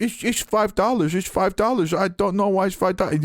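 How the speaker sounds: high put-on voice, a confused register lady; flustered cashier. Retelling the cashier's confusion over the price in a high mock voice. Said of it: "at the register was like".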